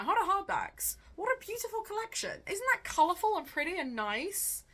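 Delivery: high, bright admiring gush; delighted gushing. Real, not acted. Vlogger gushing over her book collection, delighted.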